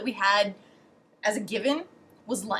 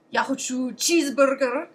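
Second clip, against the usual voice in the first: put-on loud order with a Russian accent; imitated Russian ordering voice; Russian pronunciation; 5 dB louder than usual. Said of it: "that's how you say it in Russian".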